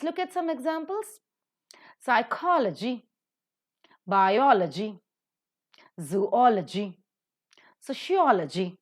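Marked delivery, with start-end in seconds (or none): 2.0-8.8 Psychology, biology, zoology, sociology with the O before -logy accented; stress third from last. Shown as a demonstration. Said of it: "automatically third from the last".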